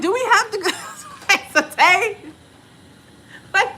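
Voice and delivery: high, loud mock whine; whiny protest. Tired traveler begging off the outing, whiny. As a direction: plead to get out of it, half laughing.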